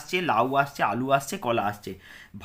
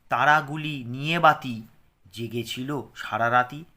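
slow, measured reading with pauses between phrases; poem recitation; slower than usual. Acted. Teacher recites the poem to pupils, slow and measured. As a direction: recite the rhyme line by line for children.

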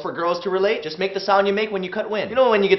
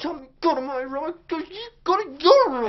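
muffled yell; 7 semitones higher than usual. Pretend. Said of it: "Shout, but don't let your mom hear it".

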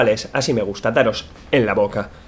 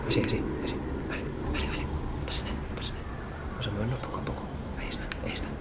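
breathy whisper, barely voiced; 3 semitones lower, 16 dB quieter, faster than usual. Real, not acted. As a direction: whisper so nobody hears. Enemy nearby, hiding.

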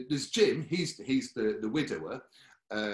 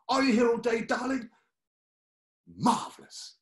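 much higher, louder posh trill; posh Molly; 11 semitones higher, 7 dB louder than usual. Retold or imitated. [name] imitates Molly asking everyone, very high. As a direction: call it out like a grand dame. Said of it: "She'd go".

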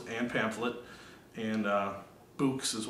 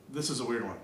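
ordinary delivery

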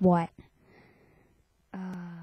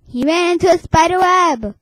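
excited; 6 semitones higher, 7 dB louder than usual. Real, not acted. Excited report.

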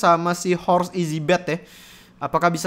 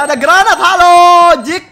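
grenade warning yell; 9 semitones higher, 11 dB louder than usual. Real, not acted. Grenade thrown in the fight.

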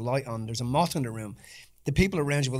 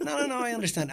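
mock reassuring; 9 semitones higher than usual. Retold own reassurance, high.

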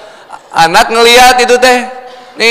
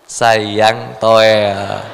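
coaxing husband; 13 semitones lower, 4 dB quieter than usual. Acted. Husband coaxing wife in bed.

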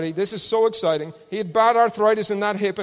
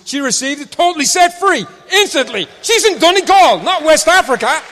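loud, high testimony; full-force preaching; 9 semitones higher, 8 dB louder than usual. Real, not acted. Preacher testifying to healings, loud and high. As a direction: proclaim it with rising fire.

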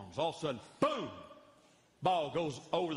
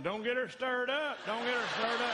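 mock-worried husbands, pitched up; warning husbands; 8 semitones higher than usual. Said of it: "some of them are looking at me going".

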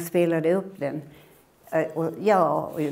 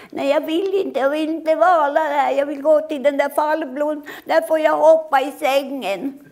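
put-on much higher, louder child's whine; whiny little boy; 10 semitones higher, 6 dB louder than usual. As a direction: whine like a sulky small boy. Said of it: "pratade med honom". (gnällig liten pojke)

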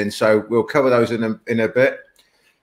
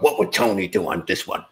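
lightly put-on, lower voice; imitated training catchphrase; 3 semitones lower, 3 dB quieter than usual. Student quoting his training mates to their coach, lightly imitated. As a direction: mimic how the training mates used to talk. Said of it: "that's how we spoke when we were training".

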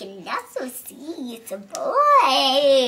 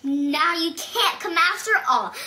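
ordinary delivery

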